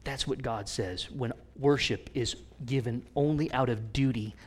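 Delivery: normal talking voice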